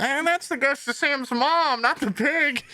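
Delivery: high, eerie ghost voice. Retold or imitated. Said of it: "I heard someone go".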